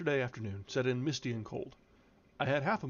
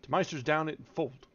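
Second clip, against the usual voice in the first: put-on gruff old Yorkshire farmhand, raised voice; thick Yorkshire dialect, barked out; broad Yorkshire accent; 3 semitones higher, 4 dB louder than usual. Joseph directing the visitor round, in broad Yorkshire. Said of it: "Good lordy, that's an accent".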